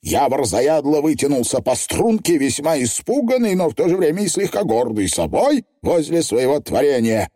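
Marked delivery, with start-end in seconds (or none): none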